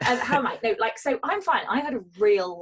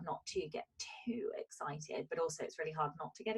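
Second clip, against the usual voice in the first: whisper; 4 semitones lower, 12 dB quieter than usual. Real, not acted. Whispering to her cohost so as not to jinx the house. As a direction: hush it like a jinx. Hushed about the house offer.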